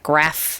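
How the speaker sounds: "graph" with short flat a, as in cat; national-ad vowel change. Shown as a demonstration. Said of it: "obviously change".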